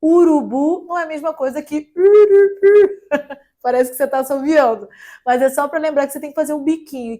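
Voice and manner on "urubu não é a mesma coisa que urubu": high, loud mock teacher quote; imitating a German teacher. Recalling a professor's line about umlaut vowels. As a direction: belt out the old teacher's catchphrase. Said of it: "tem um professor que sempre falava".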